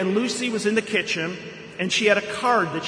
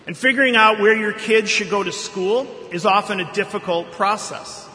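normal talking voice

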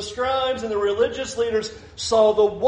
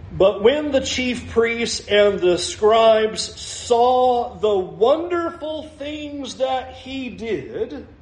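slow, drawn-out scripture reading pitched up; slow, loaded reading; 2 semitones higher, much slower than usual. Acted. Preacher savoring a verse for the congregation, slow loaded reading. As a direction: stretch the verse out word by word.

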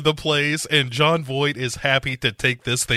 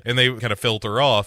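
usual speaking voice

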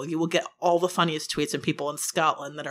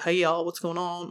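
slow, faint Southern drawl; easygoing dad with a light Southern accent; faintly Southern; 4 dB quieter than usual. Shown as a demonstration. Voicing the dad in a book, faintly Southern. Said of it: "make the parents faintly Southern".

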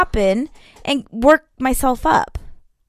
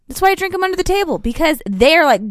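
ordinary talk

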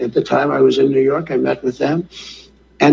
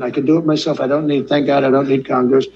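ordinary talk, nothing marked

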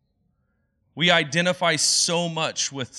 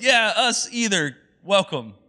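higher, louder, slow friendly agreement; hearty group welcome; 4 semitones higher, 5 dB louder than usual. Retold or imitated. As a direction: greet the newcomer like old friends. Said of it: "everybody else in the room goes".

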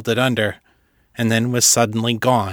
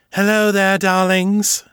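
sly vampire greeting; 9 semitones higher, slower than usual. A narrator's reading. Morgan the vampire greeting the heroes, high sly croon. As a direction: purr it like a smug vampire.

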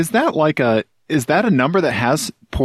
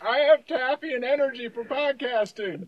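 chewing while talking; words around a mouthful of candy; mouth full; 9 semitones higher, 8 dB quieter than usual. Real, not acted. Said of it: "You really are just chewing right in the mic".

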